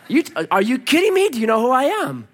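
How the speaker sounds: high, loud, incredulous challenge; acted indignation, pitch jumping up; indignant disbelief. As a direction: throw it out as a proud challenge. Repeats the proud challenge.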